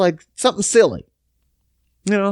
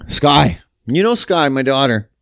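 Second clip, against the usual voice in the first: normal talking voice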